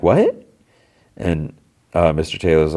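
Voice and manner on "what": a much higher, startled what with a rise; shocked what. Retold or imitated. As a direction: yelp it in disbelief. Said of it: "And Peter's like".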